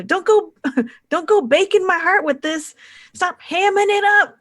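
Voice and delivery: high, loud comic squabble voice; hammy yelling. Acted. Bacon brother yells at his brother during the fight show, high hammy yell. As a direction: holler the pun like a showman. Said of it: "the bacon bros yells at each other".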